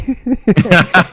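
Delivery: loud high burst of laughter; laughing. Real, not acted. Laughing at own story.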